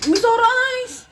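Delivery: high, slow drawn-out surprise; teasing announcement. Real, not acted. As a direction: stretch it out playfully. (mapanuksong anunsyo)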